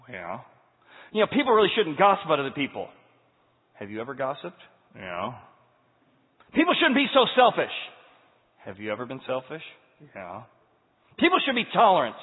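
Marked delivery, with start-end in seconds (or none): 1.1-2.9 louder, quicker, higher preachy sneer; hammed-up scolding of others; self-righteous mock. Mocking self-righteous talk. 6.5-7.7 much higher and louder smug whine; shrill mock moralizing; self-righteous mock. Mocking self-righteous talk. 11.2-12.2 much higher and louder smug whine; shrill mock moralizing; self-righteous mock. Mocking self-righteous talk.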